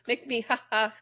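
sarcastic sing-song "ha ha"; mock the joker with a fake laugh.

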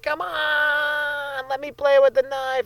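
very high, drawn-out imitation wail; siren-like child whine. Daughter begging dad for the butcher knife, mock siren-y whine. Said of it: "siren-y cry".

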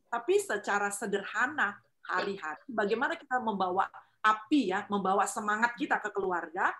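normal talking voice

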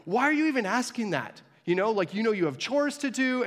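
mock-annoyed parent voice; annoyed mom. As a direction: scold like an exasperated mother. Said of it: "and she gets angry".

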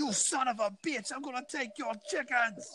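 high, slow hammed-up Irish accent, laughing through it; Irish Conor McGregor accent. Friend asks for his best Irish Conor McGregor accent, hammed-up Irish brogue. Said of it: "do it in a Conor, Conor McGregor accent".

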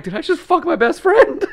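high, loud alarmed question; hammed-up high yelp of shock; mock horror. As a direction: act out dawning panic. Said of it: "I was like".

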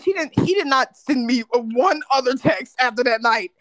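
ordinary talk, nothing marked

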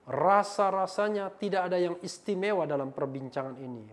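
loud, declaimed read-out; emphatic comment reading. Acted. Reads hostile comment aloud.

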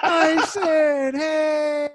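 very high, loud, laughing welcome; cheering crowd greeting. Retold or imitated. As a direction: greet him like the whole party cheering. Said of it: "Everyone's like".

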